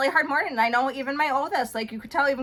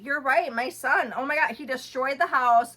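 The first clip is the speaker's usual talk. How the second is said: usual speaking voice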